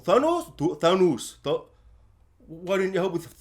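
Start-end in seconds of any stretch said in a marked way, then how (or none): 0.0-1.3 "Thanos" tried out as "tho-NOOS"; mispronounced Thanos. Retold or imitated. Trying out Jon Snow's version, "Thanos" ending in NOOS. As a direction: test a wrong "Thanos" with an oo ending. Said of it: "he says Thanos wrong".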